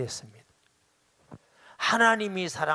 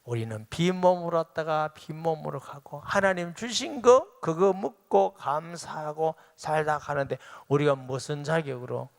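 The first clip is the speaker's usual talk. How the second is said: ordinary talk, nothing marked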